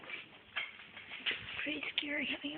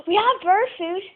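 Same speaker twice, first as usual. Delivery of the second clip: excited; 4 semitones higher, 12 dB louder than usual. Real, not acted. Excited idea, repeated.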